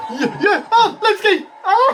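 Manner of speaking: very high, laughing screams; overjoyed squealing fan; ecstatic cheering. Real, not acted. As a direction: shriek with delight for your favourite. Fan celebrating favourite wrestler.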